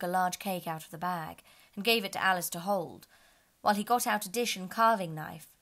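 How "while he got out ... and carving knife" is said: ordinary delivery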